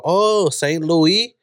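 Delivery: put-on drawn-out, Thai-accented "Saint Louis" ending as loo-EE; slow Thai-accented "Saint Louis", last syllable lifted. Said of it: "that's how you say Saint Louis in Thai".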